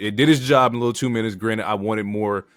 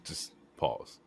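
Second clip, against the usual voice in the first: breathy whisper; 4 semitones lower, 14 dB quieter than usual. Real, not acted. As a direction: drop to a whisper on pause. Cohost stops the recap, insisting.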